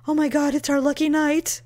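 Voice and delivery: gleeful mock voice of a lucky finder; imitating a greedy fool. Imagined stranger finding her cash, gleeful. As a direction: sound like you just struck gold. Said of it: "and go".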